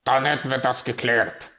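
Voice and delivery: loud, decisive raven voice; brusque raven. Acted. Raven settling the argument with the magpie, brusque. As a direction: close the matter loudly.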